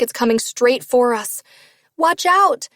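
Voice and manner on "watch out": high, loud alarmed shout. Acted. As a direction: yell a warning. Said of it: "I shout to Waylon".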